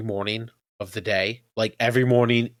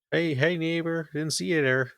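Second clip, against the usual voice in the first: higher, cheesy sitcom neighbor; 7 semitones higher than usual. Pretend. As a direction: greet like a corny TV neighbor. Playful neighbor greeting bit.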